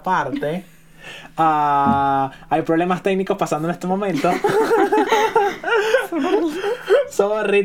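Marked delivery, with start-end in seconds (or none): 4.3-7.0 high-pitched helpless laugh; real laughing fit. Real, not acted. Host reacting to technical problems mid-recording, helpless high laughter.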